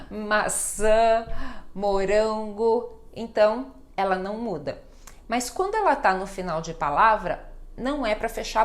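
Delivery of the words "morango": morango drawn out slowly, the M fully closed; slow teaching demo of morango; clear M sound, slow. Shown as a demonstration. Teacher slowly modelling morango for students. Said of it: "é som de M".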